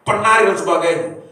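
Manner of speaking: usual speaking voice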